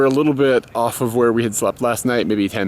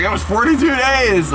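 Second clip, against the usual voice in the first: high insistent wife impression; 14 semitones higher than usual. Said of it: "she was like".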